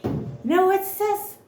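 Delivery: high, sassy "sis" aside; sassy Pluto. Pretend. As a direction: lift the voice and toss off the word "sis" with attitude. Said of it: "and Pluto's like".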